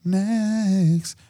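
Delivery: very slow, high and stretched; drawn-out announcer. Acted. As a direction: stretch it like a game-show host. Drawn out announcement of next question.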